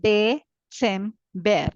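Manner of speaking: slow Dezember with ts, de-tsem-be; slow German Dezember. Shown as a demonstration. Teacher reading German December slowly. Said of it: "December is December".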